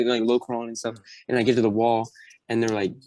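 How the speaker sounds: ordinary talk, nothing marked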